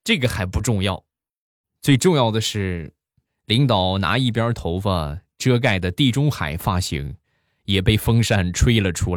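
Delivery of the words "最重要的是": ordinary talk, nothing marked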